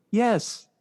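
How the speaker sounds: normal talking voice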